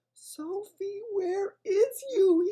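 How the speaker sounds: high, slow, anxious BFG voice; a frightened friendly giant searching for Sophie; desperate giant. A narrator's reading. BFG calls desperately for hidden Sophie, high and anxious. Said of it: "he thought desperately".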